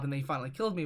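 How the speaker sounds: normal talking voice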